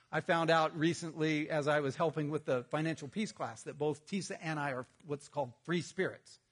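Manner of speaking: ordinary talk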